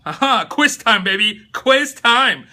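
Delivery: laughing, drawn-out quiz time; playful quiz call. Acted. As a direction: hype up a quiz for the chat like a host.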